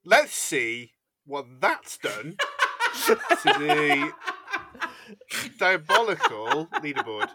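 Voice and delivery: slow "Let's see what that's done", big pauses before "diabolical"; drawn-out emphatic take. Shown as a demonstration. Co-host offering another take of the leaderboard line, drawn-out emphasis. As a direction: stretch "that's" and "diabolical" with hammy suspense. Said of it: "But what about this?".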